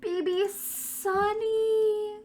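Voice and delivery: high, very slow, tearful; drawn out in grief; tearful sigh. Real, not acted. Calling her dead dog's name, slow, high and tearful. Mourning the dog.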